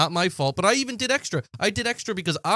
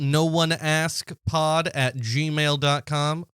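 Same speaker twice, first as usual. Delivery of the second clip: slow, deliberate address read; slow spelled-out read; much slower than usual. Shown as a demonstration. Plugging the podcast email to listeners, slow clear read. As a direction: read the address out very slowly, word by word.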